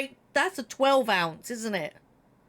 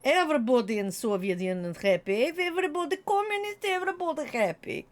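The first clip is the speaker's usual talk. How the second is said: mock Russian accent; 3 semitones higher, faster than usual. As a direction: rattle it off like a proud comrade. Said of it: "she is someone who loves communism".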